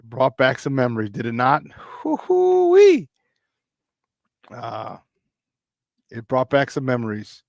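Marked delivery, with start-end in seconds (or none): 1.7-3.1 high, slow falsetto hoot; a drawn-out gleeful whoop; excited hoot. Real, not acted. Host whooping with joy at old memories, high hoot.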